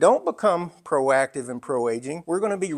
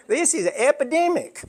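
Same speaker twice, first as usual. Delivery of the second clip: higher imitation, lightly voiced nurse; Southern head nurse; 11 semitones higher, 4 dB louder than usual. Speaker repeating the nurse's exact words, lightly imitated higher. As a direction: echo the nurse just as she said it, higher. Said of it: "That's exactly the way she said it".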